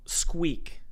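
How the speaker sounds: breathy whispered "squeak" falling away. Shown as a demonstration. Describing the hinge sound for the editor, whispered. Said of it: "a metal squeak".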